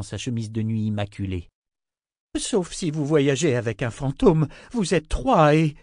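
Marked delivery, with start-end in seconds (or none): 2.4-5.8 rising protest. Acted. Amy keeps protesting, cut off.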